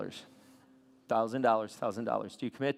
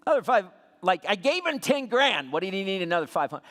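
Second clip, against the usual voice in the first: higher, louder, indignant disbelief; mock outrage; 6 semitones higher, 7 dB louder than usual. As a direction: act shocked at the short payment. Pastor retells his outraged reaction.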